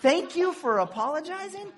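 high, loud disbelieving echo of the phrase; incredulous repeat. Real, not acted. Pastor mocking a bad reply to an apology, incredulous and loud. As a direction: throw the phrase back in disbelief. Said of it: "Oh, come on".

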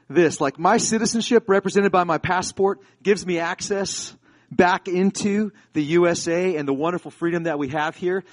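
ordinary talk, nothing marked

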